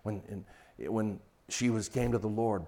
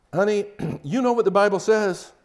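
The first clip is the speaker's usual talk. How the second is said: higher, louder lecturing husband; preachy husband; 7 semitones higher, 8 dB louder than usual. Pretend. Husband lecturing his stressed wife, preachy and loud. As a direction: talk down to your wife. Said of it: "That's educating her".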